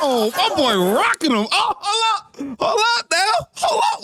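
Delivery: can't believe the fight broke out; excited shouting. Real, not acted. Fight breaks out, reacting live.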